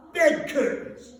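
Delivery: high, slow, loud boast; brazen charwoman. Acted. Charwoman showing Joe her loot, high slow proud voice. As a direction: savour it proudly.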